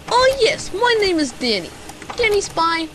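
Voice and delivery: high, loud startled yes; flustered. Acted. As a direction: caught off guard, cover quickly. Caught out by client.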